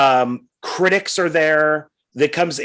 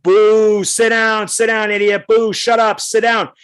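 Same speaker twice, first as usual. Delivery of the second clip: higher, loud mock booing from the audience; crowd booing; 6 semitones higher, 4 dB louder than usual. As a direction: jeer her down like an annoyed crowd. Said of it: "And everybody's like".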